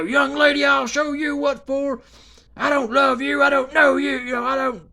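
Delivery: hammed-up feisty mother shouting; imitating mama's shout. Mama threatening the chat fan, loud shouted threat.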